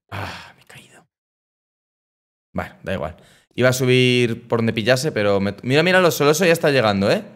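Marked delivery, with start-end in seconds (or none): 0.1-3.2 breathy half-whispered grumble; under breath. Real, not acted. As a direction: mutter it almost voiceless. After falling, half to himself. (susurro resignado)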